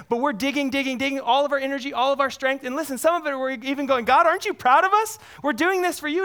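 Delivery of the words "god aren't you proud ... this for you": eager churchgoer voice, higher and louder; mock proud boast. Preacher voicing churchgoers seeking God's praise, eager higher mock boast. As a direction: boast to God like a kid wanting praise.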